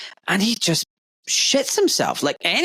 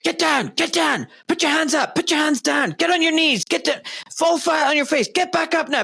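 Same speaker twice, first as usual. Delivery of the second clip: high, shouted cop commands fired off in a row; barking cop orders; 12 semitones higher than usual. Retold or imitated. Retelling the cop yelling contradictory orders at him. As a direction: bark out orders like an angry cop.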